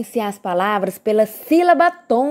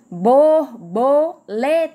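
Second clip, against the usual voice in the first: "Borboleta" slowly split as bor, bo, le, ta; four stretched beats in "Borboleta"; syllable by syllable; much slower than usual. Shown as a demonstration. Teacher separating the next word, "Borboleta" said in separated syllables. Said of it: "pra separar essa palavra".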